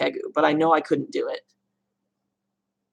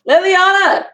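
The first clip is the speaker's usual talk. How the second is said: high, loud call of a name; 13 semitones higher, 6 dB louder than usual. Real, not acted. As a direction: sing out the name to get her attention. Calls out to Leliana nearby.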